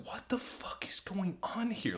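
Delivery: whispered disbelief; hushed, incredulous aside to friends; stunned whisper. Retold or imitated. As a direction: whisper it, frozen in shock. Frozen friends confer in secret.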